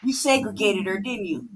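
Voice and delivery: ordinary talk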